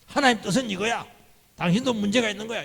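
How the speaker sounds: high-pitched, blunt correcting tone; 정답을 팍 말하듯. Acted. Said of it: "정답을 팍 이야기합니다". (높은 소리로 단정하듯)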